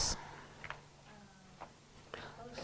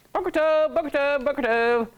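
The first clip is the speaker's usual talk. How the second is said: bright "Boker Tov", said higher; cheerful greeting; 5 semitones higher than usual. Shown as a demonstration. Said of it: "repeats greeting as model".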